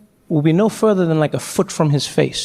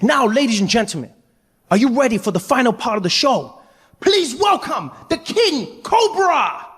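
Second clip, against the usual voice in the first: Deng Basan; 7 semitones higher than usual. Acted. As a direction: build up to a huge grand reveal. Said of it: "Deng Basan came out one final time".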